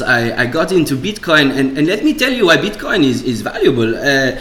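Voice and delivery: Israeli Bitcoin shill, singsong with hesitant "uh"s; mock Israeli accent, swinging up and down in pitch. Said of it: "a Bitcoin shill in an Israeli accent".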